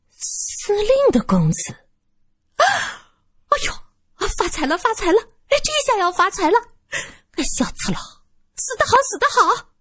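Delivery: 高声尖笑着喊发财; 财迷心窍般尖声狂喜. Acted. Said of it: "老女人突然大笑". (老女人听说能讹钱尖声狂喜)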